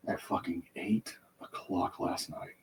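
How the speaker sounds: slow, bitter whisper; hissed whisper. Real, not acted. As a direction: spit it out under your breath. Angry retelling, drops to whisper.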